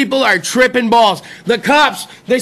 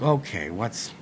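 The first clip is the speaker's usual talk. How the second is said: hushed worry; 13 dB quieter than usual. Retold or imitated. Son answering mom, hushed.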